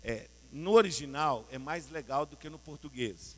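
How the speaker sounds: ordinary delivery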